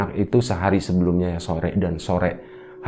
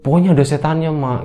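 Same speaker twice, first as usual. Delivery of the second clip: high, insistent imitation of a child; insistent scared child; 8 semitones higher, 5 dB louder than usual. Child insists to father about the devil, acted alarm. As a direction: play a scared child who won't back down. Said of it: "teriak sambil nangis".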